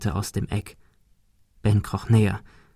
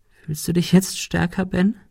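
higher, wounded creature voice; a beaten creature reproaching Ben; hurt, higher reproach from the goat; 7 semitones higher, 3 dB quieter than usual. Acted. Monika's quiet jab.

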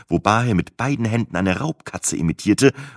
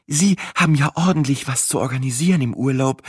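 lightly voiced farmer's wife, higher pitched; kindly farmer's wife; 7 semitones higher than usual. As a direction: sympathetic host in a raised pitch.

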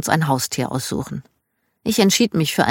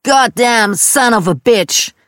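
higher, louder, slow furious Southern cursing; shouted curse; 6 semitones higher, 5 dB louder, slower than usual. Retold or imitated. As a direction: swing at the animal and bellow it. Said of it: "wie mein Dad schrie".

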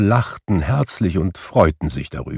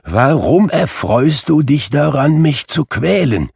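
pleading Scrooge; 8 semitones higher, 5 dB louder than usual. A narrator's reading.

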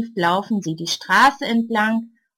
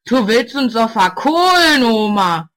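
louder, harsh retort; teen snaps at the neighbour, much louder; snapping; 2 semitones higher, 7 dB louder than usual. A narrator's reading. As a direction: bark it back at the old woman. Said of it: "Ein anderer blafft".

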